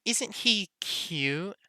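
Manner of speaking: dreamy lovesick schoolgirl; swooning lovesick voice. Acted. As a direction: swoon over him, lightly. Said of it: "swooning, sick love voice".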